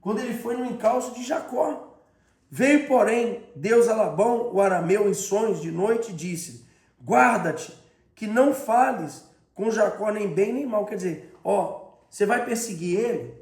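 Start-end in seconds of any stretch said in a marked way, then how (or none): 2.5-10.9 loud, emphatic scripture reading; preaching scripture. Acted. Preacher proclaiming Bible verse to church, loud and emphatic.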